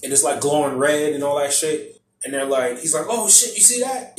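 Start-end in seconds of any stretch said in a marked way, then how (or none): 3.1-4.1 high-pitched retold disbelief; mock astonishment. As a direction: react stunned, pitch jumping up. Acting stunned reaction again.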